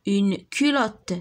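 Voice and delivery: very slow, syllables pulled apart; slow modelled word. Shown as a demonstration. Teacher reading a word to children, slowly. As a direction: sound it out slowly for pupils. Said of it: "teacher models word slowly".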